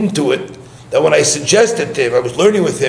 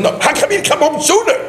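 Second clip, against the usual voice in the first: fast, much higher and urgent; agitated; 12 semitones higher, faster than usual. Retold or imitated. To the rabbi back from trip, agitated and rushed. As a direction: press the question in a panic. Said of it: "so agitated".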